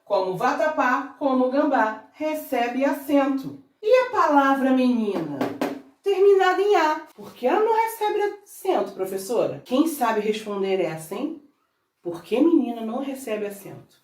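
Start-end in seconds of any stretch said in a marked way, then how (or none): 6.0-9.6 lightly voiced pupil, pitched high; playing a puzzled student, high until the last word; student asking teacher. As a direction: put a pupil's question in a higher voice.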